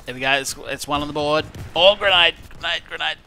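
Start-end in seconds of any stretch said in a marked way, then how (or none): 1.8-3.2 sudden loud grenade warning; grenade warning yell. Real, not acted. Grenade lands nearby.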